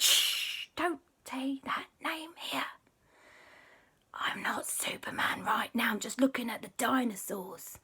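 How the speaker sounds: urgent shush into a high whisper; secretive whisper. Acted. Superman hides his identity at the museum, whispering. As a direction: hush them to guard your secret.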